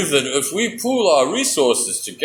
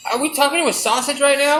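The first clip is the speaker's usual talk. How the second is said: higher, fast mouse voice; a lightly pitched-up mouse, rushed; 8 semitones higher, faster than usual. Acted. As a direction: blurt it out in disbelief. Mouse in skit.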